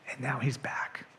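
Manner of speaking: hushed dramatic whisper; softly whispered reveal. Acted. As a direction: breathe it out as a secret. Climax of prodigal son retelling.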